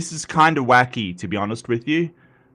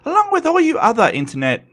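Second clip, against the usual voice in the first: much higher and quicker, rising with energy; excited hype; 10 semitones higher, 3 dB louder, faster than usual. Acted. Streamer hyping up his internet viewers, excited. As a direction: lift off into a hype voice.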